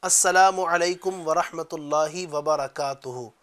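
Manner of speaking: slow, every syllable of "السلام علیکم ورحمۃ اللہ وبرکاتہ" spelled out; full careful greeting. Shown as a demonstration. Teacher modelling the full greeting, slow and careful. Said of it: "مزید اضافہ کر لیں".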